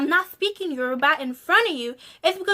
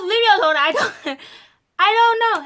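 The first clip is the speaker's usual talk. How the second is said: louder, higher, half-crying leave me alone; mock upset outburst; 7 semitones higher, 8 dB louder, faster than usual. Auntie snapping at her niece, loud and exasperated. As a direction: throw up her hands in mock frustration. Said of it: "She goes, she goes".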